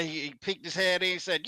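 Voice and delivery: ordinary delivery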